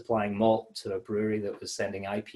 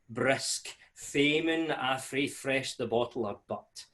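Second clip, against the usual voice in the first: put-on Scots poem recited in a broad Scottish accent; a hearty Scottish recital of an old Scots verse; 2 semitones higher than usual. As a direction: roll it out like a proud Scots reciter. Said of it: "I wanna hear it in a Scottish accent".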